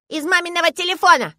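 put-on high, loud childish announcement; triumphant little girl. A narrator's reading. Girl revealing her proof to father, triumphant. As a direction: crow it like a victorious small child. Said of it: "торжествующе заявляет Валя".

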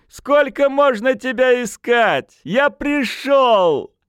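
put-on high, drawn-out drunken holler; loud, slow rowdy yell; shouting. A narrator's reading. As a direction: bellow it up at the window, stretching the words. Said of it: "крикнул парень".

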